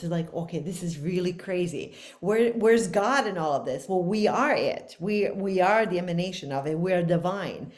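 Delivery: usual speaking voice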